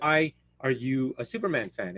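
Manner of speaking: usual speaking voice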